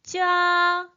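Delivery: 쫙 held long on one high, level pitch; first tone. Shown as a demonstration. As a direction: draw 쫙 out high and even, like underlining. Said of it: "일성". (쫙을 높고 평평하게 길게, 일성으로)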